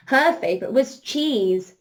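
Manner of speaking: normal talking voice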